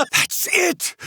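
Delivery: high, thrilled announcement; eager exclamation. Acted. Scholar picks where to start exploring, thrilled and eager. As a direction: seize on the idea with delight. Decides what to explore first.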